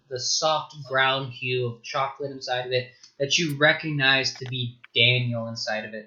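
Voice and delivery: ordinary talk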